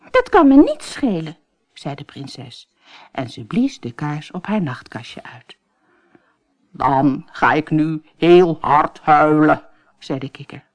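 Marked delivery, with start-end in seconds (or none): 0.1-1.4 high, loud, defiant; defiant princess. Acted. Princess dismisses the frog's threat, high and defiant. 6.7-9.7 slow, louder sulky croak; sulky frog. Acted. Frog threatens the princess, slow and sulky.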